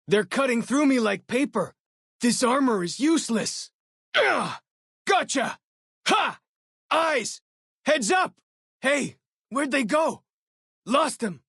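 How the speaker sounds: high, loud battle cries; combat shouts. Acted. Companion shouting during a firefight, loud battle cries. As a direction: yell it over the fighting. Companion mid-fight barks.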